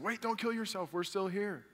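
high-pitched call without much volume; urgent calling out. Acted. Paul and Silas stopping the jailer, urgent. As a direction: call out to stop someone, lightly acted.

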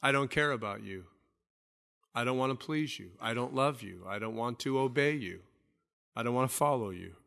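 low, soft, cold voice of someone turning from God; defiant sinner's voice. Acted. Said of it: "It is saying to God".

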